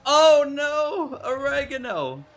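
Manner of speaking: high, loud cry of dismay. Real, not acted. Streamer reacting to a crash mid-race, dismayed. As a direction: yelp it as the crash hits.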